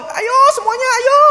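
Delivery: hammed-up fire alarm shout; panicked shouting. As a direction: scream fire like a panicked bystander. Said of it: "cuma lari-lari doang".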